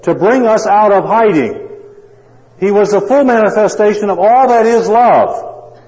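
loud, high, climbing preacher's fervour; preaching at full force. Acted. Preacher pressing the congregation about Christ, full force.

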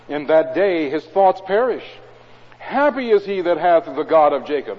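solemn scripture proclamation; psalm reading. Acted. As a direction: lift the psalm to the congregation. Reads psalm aloud.

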